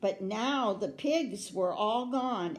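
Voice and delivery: usual speaking voice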